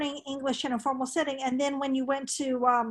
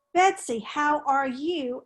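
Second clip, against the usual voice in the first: slow, measured polite greeting; courteous and slow, expecting a set reply; formal greeting; slower than usual. Shown as a demonstration. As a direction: ask it formally, word by word. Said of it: "goes back to the formal and informal".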